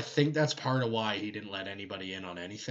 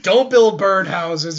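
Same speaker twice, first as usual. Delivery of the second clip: slow, loud, higher mock command; mock stern order; 7 semitones higher, 13 dB louder, slower than usual. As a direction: bark it out slowly like a hammed-up decree. Mock quote of the Emperor.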